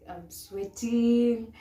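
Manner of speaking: a hammed-up scared "I'm sweating"; mock nervous. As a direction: imitate a scared contestant whining slowly. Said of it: "scared and they're just like".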